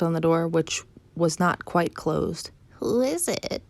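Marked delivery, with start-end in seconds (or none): 2.8-3.6 high swooping fake granny; wolf posing as grandmother. Wolf calls from grandmother's bed, high swooping pretence. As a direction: imitate granny, rising then falling.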